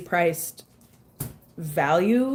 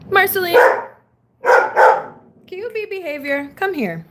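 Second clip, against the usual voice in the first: scolding the dog; 8 semitones higher, 4 dB louder than usual. Real, not acted. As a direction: plead with the dog in a pet voice.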